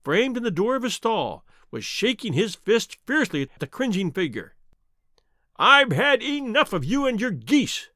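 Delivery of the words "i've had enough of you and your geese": raised, slow angry outburst; fed-up salesman shouting. A narrator's reading. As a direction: shout it in exasperation, pitched high. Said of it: "he shouted".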